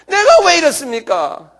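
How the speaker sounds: loud, high, slow anguished plea; crying out. Shown as a demonstration. Preacher acting out a mourner's prayer, crying out slowly. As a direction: wail the question up to God. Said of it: "소리 질러가면서요".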